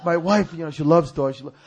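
ordinary talk

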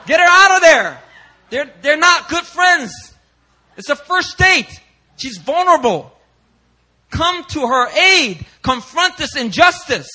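high, loud, indignant preaching shout; outraged shout. Real, not acted. Preacher to the church, outraged at bystanders, shouting. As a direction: blast the crowd with disbelief at the injustice.

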